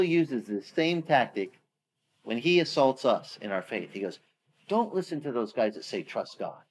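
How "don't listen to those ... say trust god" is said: "Trust God" dropped low and very soft; devil's whisper. Retold or imitated. The devil tempting a believer, low soft murmur. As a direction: hiss it like temptation. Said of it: "That's what sin says".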